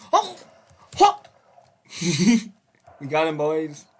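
loud excited yell with a laugh; excited shout. Real, not acted. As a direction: cheer the win out loud.

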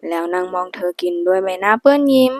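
ordinary delivery

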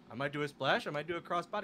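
low, soft, offhand son's voice; sheepish son. Retold or imitated. Son mumbling his plan to his worried dad. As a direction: shrug it off like a nervous kid.

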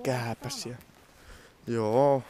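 usual speaking voice

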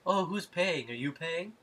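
sing-song character voice, coyly asking; flamboyant voice. Retold or imitated. Arosio quoted at the bar.

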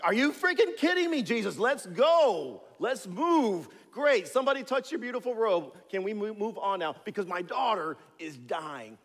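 impatient father snapping at Jesus to hurry; ticked off. Retold or imitated. Jairus rants at Jesus to hurry for his dying daughter, furious. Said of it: "I'm ticked off".

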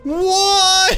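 high, loud wail of disbelief; loud gamer's outcry; dismayed cry. Real, not acted. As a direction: cry out at a failed attempt. Anguished outcry at failed attempt.

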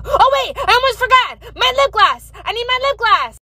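high-pitched comic panic; panicked. Acted. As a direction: squeal it like a sudden emergency. Panics over forgotten lip gloss.

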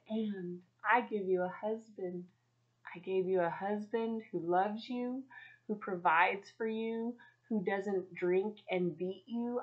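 gently reassuring, like a patient parent; God lovingly answering a complaining mom. Retold or imitated. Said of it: "so lovingly came to me and said".

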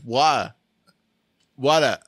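WATER repeated in bad British, R dropped; British accent. Shown as a demonstration. Host insisting that's British, hammed-up British accent. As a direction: proudly demonstrate a clumsy British WATER. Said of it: "That's British".

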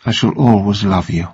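a quiet tender farewell; softly. A narrator's reading. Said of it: "and said softly".

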